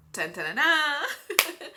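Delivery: tearful mock fanfare. Real, not acted. Mock fanfare before God's answer.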